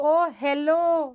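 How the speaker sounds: very slow, drawn-out mocking "ओ hello"; sneering "ओ hello" with the "ओ" dragged long; sarcastic hello. Shown as a demonstration. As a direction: stretch out "ओ hello" like sarcastic mockery. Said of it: "ये भी अलग sound कर रहा है".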